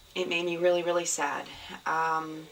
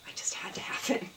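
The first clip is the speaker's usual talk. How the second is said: whispered longing; 7 dB quieter, faster than usual. Real, not acted. Whispering to viewers about the pink shawl she loves. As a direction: hush it like a secret craving.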